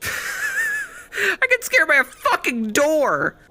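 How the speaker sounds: high, slow, laughing through the words. Real, not acted. Streamer laughing at being scared by a door, high. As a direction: laugh at yourself after the fright.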